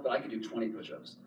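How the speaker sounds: ordinary talk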